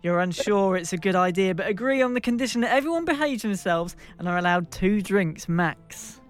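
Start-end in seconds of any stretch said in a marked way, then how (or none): none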